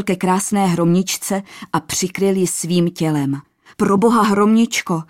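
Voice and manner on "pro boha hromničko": higher, louder cry of alarm; horrified outburst. A narrator's reading. King shields the princess from falling rocks, horrified. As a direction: blurt it out in horror. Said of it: "vyhrkl zděšeně".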